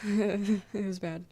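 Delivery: laughing through it, slowed right down. Real, not acted. Streamer laughing while telling chat the chat went badly. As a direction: let a laugh spill over the words. Laughing about meeting Scots person.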